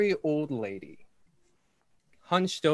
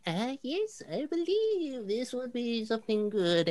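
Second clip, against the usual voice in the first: put-on high, wavering old woman; old lady voice; 12 semitones higher than usual. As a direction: voice a frail, very old shopkeeper. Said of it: "is like".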